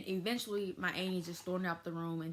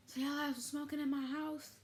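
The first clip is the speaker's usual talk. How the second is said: higher-pitched mock interrogation; retold accusing question; 8 semitones higher than usual. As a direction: imitate a relative demanding answers. Relative confronts the teens in retold scene.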